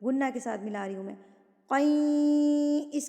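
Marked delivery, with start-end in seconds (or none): 1.7-2.9 قین held long through the nose; drawn-out nasal ghunna. Shown as a demonstration. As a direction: hum the nasal sound into قین. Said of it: "ناک میں آواز چلی گئی".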